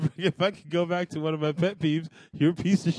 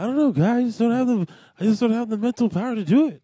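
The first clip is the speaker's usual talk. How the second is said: higher-pitched, bouncy celebrity impression; Mark Wahlberg; 4 semitones higher, 3 dB louder than usual. Co-host pressed for a new voice answers as Mark Wahlberg. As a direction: plead ignorance in a raised put-on voice. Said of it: "Mark Wahlberg".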